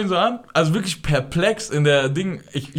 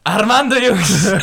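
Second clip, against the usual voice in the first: loud call across the flat; 6 semitones higher, 6 dB louder, slower than usual. Retold or imitated. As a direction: shout the name to the room. Calling out to friends in flat. (laut rufen)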